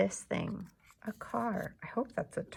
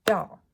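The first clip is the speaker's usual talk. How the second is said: "Doll" as one loud, short beat; clapped-out syllable; 9 dB louder than usual. Shown as a demonstration. Teacher clapping out doll with pupils, "Doll" in one loud beat. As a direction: punch "Doll" out on a single clap. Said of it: "Let's clap out doll".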